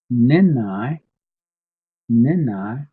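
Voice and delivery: "Nenai" high and loud, first syllable hit hard; emphatic pronunciation. Shown as a demonstration. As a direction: punch "Nenai" as a model for learners. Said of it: "Emphasize on that".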